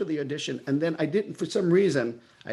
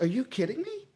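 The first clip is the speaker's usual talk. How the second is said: high-pitched mock disbelief; retold freak-out; 10 semitones higher than usual. Actor hearing he got the part, high disbelief. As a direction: react in stunned disbelief, pitched up. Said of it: "And I freaked out".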